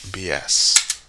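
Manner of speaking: normal talking voice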